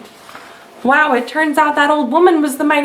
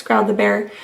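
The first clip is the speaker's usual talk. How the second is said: plain reading voice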